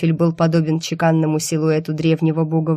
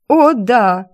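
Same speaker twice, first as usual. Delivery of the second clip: eager agreement; 5 semitones higher, 7 dB louder than usual. A narrator's reading. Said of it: "закивала я".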